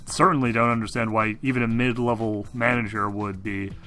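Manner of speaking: ordinary delivery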